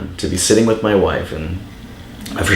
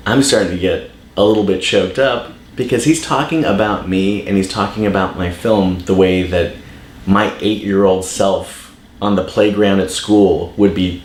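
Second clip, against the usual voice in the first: ordinary talk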